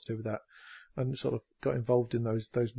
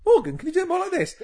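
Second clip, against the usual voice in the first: mock director; 4 dB louder than usual. Director mimicking request.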